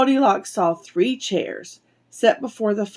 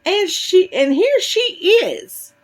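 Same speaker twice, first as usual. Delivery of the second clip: high, loud little squeak; high baby-bear voice, drawn out; 10 semitones higher, 4 dB louder, slower than usual. A narrator's reading. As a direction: squeal it out as a tiny cub. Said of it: "squeaked in his tiny little voice".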